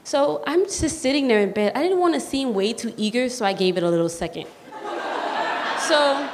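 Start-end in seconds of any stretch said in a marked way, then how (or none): 4.5-5.8 breathy laugh. Real, not acted. Laugh after pausing on the phone.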